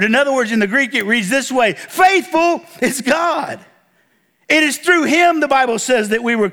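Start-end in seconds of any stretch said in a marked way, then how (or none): 1.7-3.6 Faithful drawn out high, then a slower "is God"; emphatic, slow declaration led by FAITHFUL; stress on FAITHFUL. Shown as a demonstration. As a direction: hit "Faithful" hard and high, stretched slowly. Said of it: "The stress is on the first word".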